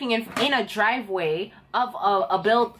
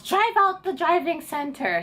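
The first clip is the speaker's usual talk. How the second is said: high-pitched mocking impersonation of a bossy examiner; put-on examiner voice, pitched up; 7 semitones higher than usual. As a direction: order the driver off in a high put-on voice. Acting out the examiner's order.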